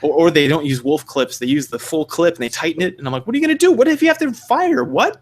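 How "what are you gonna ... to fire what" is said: high-pitched, baffled questioning; squeaky-high, hammed-up bewilderment; mock incredulity. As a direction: pitch it way up in exaggerated disbelief. Mock disbelief at posers' gear.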